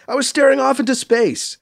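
high-pitched indignant defence; protesting. A narrator's reading. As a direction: defend yourself against teasing. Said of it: "B C protests".

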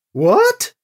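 high-pitched startled question; alarmed. Acted. Krish hearing of the raid, alarmed. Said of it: "Krish said, alarmed".

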